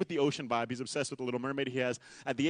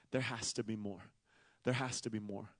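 hushed earnest; 6 semitones lower, 10 dB quieter than usual. Acted. Preacher drops voice.

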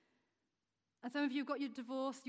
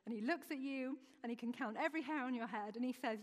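usual speaking voice

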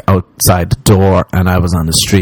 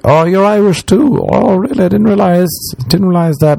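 high-pitched polite mock surprise; awkward small talk; 10 semitones higher than usual. Strangers making awkward talk with a fellow Irishman, high polite surprise. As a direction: feign interest in a raised, airy social voice. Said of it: "I was awkward talking to people".

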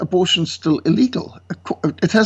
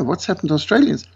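usual speaking voice

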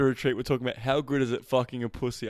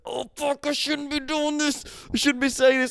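much higher put-on voice, mock guilt; mock guilty guy; 11 semitones higher, 3 dB louder than usual. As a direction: squeak it out like a nervous guy. Mocking guy's guilty reaction.

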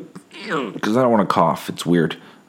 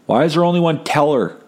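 a much higher, exasperated cry; fed-up complaint; 10 semitones higher than usual. Retold or imitated. Man in line complains about one teller, exasperated higher cry. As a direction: let the impatience burst out. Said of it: "the guy behind me finally was just like".

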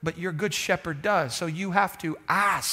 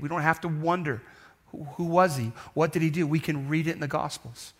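usual speaking voice

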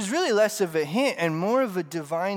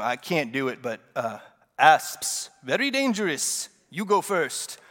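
movie line quote; 2 semitones lower than usual. Retold or imitated. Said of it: "that guy with just the amazing voice rolls over and goes".

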